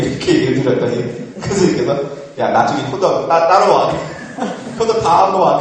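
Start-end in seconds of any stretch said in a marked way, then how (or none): 2.4-5.6 louder, higher mock order from an elder monk; senior monk's reply. Senior monk to would-be novice, light mock command. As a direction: imitate an elder's loud send-off through laughter.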